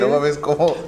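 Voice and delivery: normal talking voice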